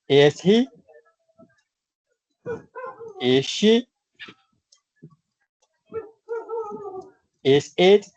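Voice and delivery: each "is he", "is she", "is it" ending high as a question; question tune. Shown as a demonstration. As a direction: ask each one with a lifted end. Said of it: "Y si es la pregunta". (entonación de pregunta en "is he", "is she", "is it")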